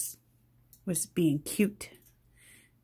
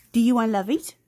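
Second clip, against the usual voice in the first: normal talking voice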